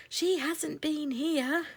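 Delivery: high, slow small voices; mice replying. A narrator's reading. Mice in the blackthorn reply, high and slow. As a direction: answer from up in the bush. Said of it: "replied the mice".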